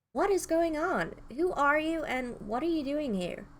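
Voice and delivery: pressing questions from someone lost; overwhelmed. Acted. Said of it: "Everything about him screamed overwhelmed".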